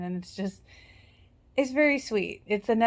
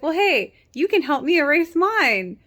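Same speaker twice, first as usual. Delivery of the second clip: put-on higher, louder, playful girl's voice; mock Anna voice; 6 semitones higher, 5 dB louder than usual. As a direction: voice her light and a bit higher. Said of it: "And she's like".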